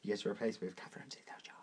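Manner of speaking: whispered hint behind a hand. Real, not acted. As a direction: breathe it as a secret. Secret hint whispered during game.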